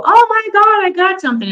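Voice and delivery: high, loud mock excitement. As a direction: squeal it like a giddy ghost hunter. Mock excited ghost hunter.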